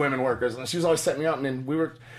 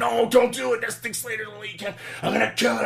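mock frantic plea; 8 semitones higher than usual. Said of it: "Rusty's like".

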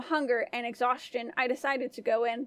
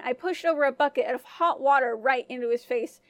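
usual speaking voice

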